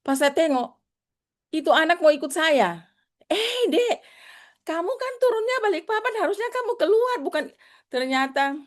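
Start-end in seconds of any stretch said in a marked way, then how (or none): 3.3-7.4 much higher, surprised calling out; startled high call. Retold or imitated. Teacher catching the girl following her aboard, startled high call.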